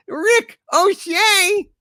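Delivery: the punchline screamed high and slow; high raging repeat. Acted. Furious character shouting the pun back at chat. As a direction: spit the pun back in outrage.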